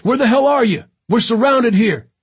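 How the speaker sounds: high, loud demand; urgent shouting. A narrator's reading. Surrounded Katz demanding London's position, urgent high shout. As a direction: yell for help under attack.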